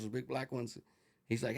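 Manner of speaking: ordinary talk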